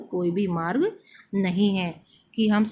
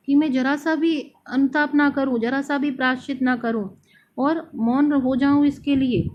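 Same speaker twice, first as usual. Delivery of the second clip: higher and louder, pleading; anguished insistence; 5 semitones higher, 5 dB louder than usual. Retold or imitated. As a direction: press every phrase as the guilty queen. Voices Kaikeyi's remorse, intensifying. (ज़ोर देकर, ऊँचे स्वर में पश्चाताप)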